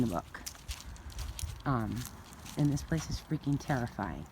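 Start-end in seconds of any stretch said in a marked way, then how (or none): none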